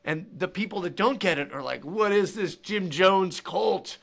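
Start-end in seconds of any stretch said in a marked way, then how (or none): 1.8-4.0 slow, loud, incredulous question; mock baffled local. Quoting locals who miss the joke, slow loud mock disbelief. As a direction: drag out the disbelief like an outraged outsider.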